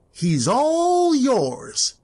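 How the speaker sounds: very slow, drawn out and menacing; ominous. Acted. Spirit handing Scrooge over to his fate, slow ominous. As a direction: let each word hang like a sentence of doom.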